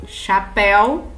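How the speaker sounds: chapéu, cha-PÉU, slow and clear; slow example word. Shown as a demonstration. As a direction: stretch chapéu, leaning on the open é.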